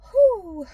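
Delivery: high, loud falling "whew"; high whoop. Real, not acted. Driver pausing before resuming the chat, high whoop. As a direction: let out a sudden high whew. Wordless high exclamation while driving.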